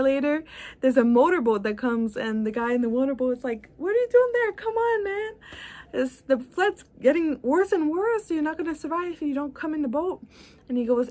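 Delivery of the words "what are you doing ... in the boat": urgent rescuer. Acted. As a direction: shout it up high like a worried boatman. Motorboat rescuer voiced in story.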